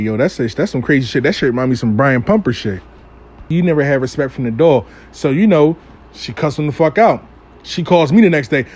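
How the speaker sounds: normal talking voice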